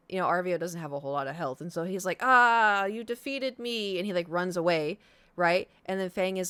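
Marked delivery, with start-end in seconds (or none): 2.2-4.0 hammy defeat. Retold or imitated. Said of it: "all very goofy".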